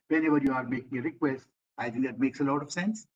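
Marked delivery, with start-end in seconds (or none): none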